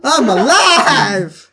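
hammed-up revival cheer; laughing cheer. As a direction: stretch it out, delighted. Character revived by good roll.

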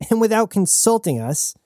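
high, scornful tag-on; a lightly voiced snide crew woman; Aruda's sneering jab. Aruda sneering at the captain for not asking.

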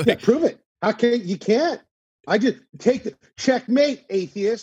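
loud, high mock gloating; hammed-up believer taunting an atheist. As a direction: crow over a fake checkmate, hammed up.

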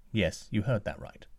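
usual speaking voice

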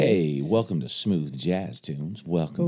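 low, slow late-night DJ voice; smooth jazz radio voice. Pretend. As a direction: purr it like a jazz radio host. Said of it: "I like your voice. It's getting real sexy".

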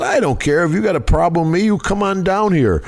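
taunting challenge. Retold or imitated. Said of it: "He goes".

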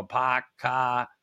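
dropped R Boston vowels; broad Boston, no R's; Boston accent. Shown as a demonstration. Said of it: "I can't say my fucking R's".

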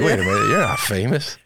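a light hint of children's voice; kids catching on. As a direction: play the kids seeing through him. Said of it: "they're gonna be like".

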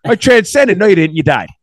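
loud, high, incredulous retort; mock argument. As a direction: argue back in exaggerated disbelief. Acted argument with his father.

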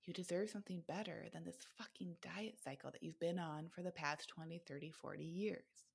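soft, intimate self-talk; quiet inner voice. Pretend. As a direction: murmur it like a voice inside your head. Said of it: "that little voice inside of ourselves that is saying".

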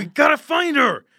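high, loud desperate yell; panicked shout. Acted. Hans yelling to the party that they must find her, desperate shout.

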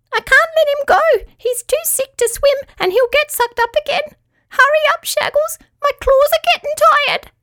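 very high strained squeaky voice; tiny creature holding on with all its strength; high squeak under strain. Acted. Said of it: "in a strained voice".

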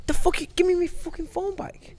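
mock outraged innocent man; indignant outburst. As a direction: snap back at the accuser. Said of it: "You'd be in the face going".

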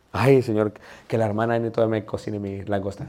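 low, softened mock plea; muttered prayer. Preacher praying that his lobster gets cooked, soft and low. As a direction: pray quietly, pitch dropped. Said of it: "Estoy orando".

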